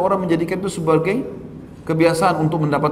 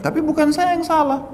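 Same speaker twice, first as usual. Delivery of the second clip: much higher-pitched retold protest; insistent denial; 14 semitones higher than usual. Driver denies fault to his accusers, insistently.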